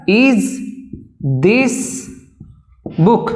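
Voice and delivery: one word at a time with long pauses; word-by-word dictation. Shown as a demonstration. Teacher pausing between words as students write. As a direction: set each word apart for writing. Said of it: "লেখা যাক".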